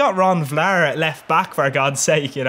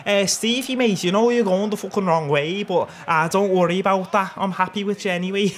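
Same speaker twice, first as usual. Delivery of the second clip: higher-pitched put-on Scouse; Scouse accent; 5 semitones higher than usual. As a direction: reassure a mate in mock Scouse. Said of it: "That's a beautiful Scouse accent right there".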